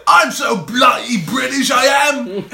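high, slow, shouted British accent; obnoxious mock British. Shown as a demonstration. Showing a friend how to embarrass himself, obnoxious British accent. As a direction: ham it up as an insufferable Brit. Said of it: "Just sound like a fucking dick".

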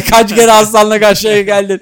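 can barely get it out for laughing. Real, not acted. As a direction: tease through real bursts of laughter. Cracking up while mocking the friend.

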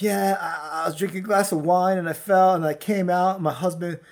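parodying a stroke survivor praising her husband; mock sentimental woman. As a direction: gush like a video testimonial. Imitating woman in stroke video.

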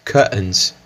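curtains said kɐtəns, no r; British curtains. Shown as a demonstration. Teacher repeats British curtains. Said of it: "pessoas falando curtains".

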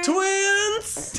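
high excited shout. Real, not acted. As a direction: blurt it out high. Excited outburst.

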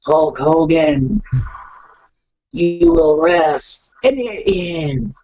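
drawn-out, slow menacing promo; slow ominous cadence. Retold or imitated. Paul Bearer threatening Hulk Hogan, slow and ominous. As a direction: stretch each word like a funeral threat. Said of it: "he had that cadence to his voice".

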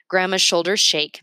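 plain reading voice

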